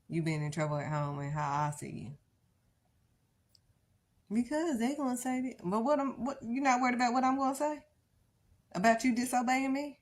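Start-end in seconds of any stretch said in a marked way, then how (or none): 4.3-10.0 put-on high, loud and indignant, as God rebuking a child; God scolding. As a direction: voice God's hurt outrage, pitched up. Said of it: "That's what God is saying".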